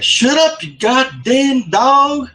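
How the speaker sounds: high, drawn-out holler at a dog; mock yell. Teasing coworker by voicing his dad, mock yell. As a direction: yell it loud and high, stretching the words.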